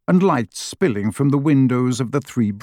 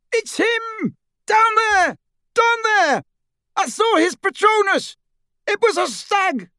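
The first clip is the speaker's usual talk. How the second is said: high-pitched distant shouting; triumphant yell. A narrator's reading. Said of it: "there was a triumphant yell".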